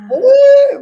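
sudden high, loud "uy"; a sharp cry of shock; a real burst of emotion; startled cry. Retold or imitated. Reliving the breakdown moment.

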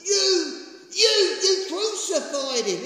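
loud, high, slow accusation; stern rebuke. Acted. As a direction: hurl the accusation at the crowd. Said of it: "He rebuked them".

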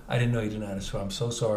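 low, soft and contrite; retold apology. Apologizing to crying girl who couldn't swim, low and soft. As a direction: drop down gently to console her.

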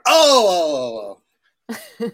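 high, loud drawn-out "oh"; mimicked French "oh". French puppeteer reacting to the correction, mimicked exclamation.

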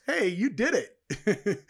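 mocking a pointless plaque with loud, high, laughing cheer; mock congratulations. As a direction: ham up a fake "you did it" while laughing.

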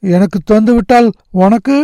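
high-pitched, puzzled question; concerned boy. A narrator's reading. Nagarajan asks what his friend will have, high and concerned. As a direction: ask with worry, voice lifted high.

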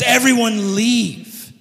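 slow, firm order; commanding Joseph. Retold or imitated. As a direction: clear the room slowly. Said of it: "And Joseph says".

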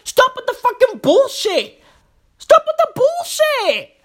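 whiny outburst climbing to a squeal; mock-outraged squeal; high-pitched outrage. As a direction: plead in a very high, exasperated whine.

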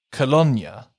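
"Cologne" as "ko-LONE-ya"; cologne like Bologna. Shown as a demonstration. Host demoing the swap, cologne like Bologna. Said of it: "cologne was pronounced like Bologna".

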